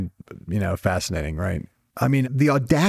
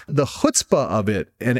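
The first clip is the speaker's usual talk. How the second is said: ordinary talk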